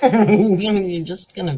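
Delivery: laughing, slow and loud. Real, not acted. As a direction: laugh it out at the dog. Laughing at dog.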